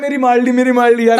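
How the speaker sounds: a hammed-up yell half lost in giggles; laughing mock yell. Retelling the injured uncle's cry, laughing. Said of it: "वो कह रहा है".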